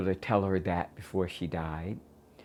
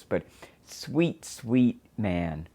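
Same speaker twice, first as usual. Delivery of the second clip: usual speaking voice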